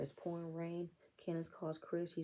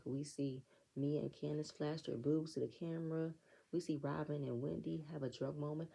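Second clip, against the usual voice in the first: ordinary talk, nothing marked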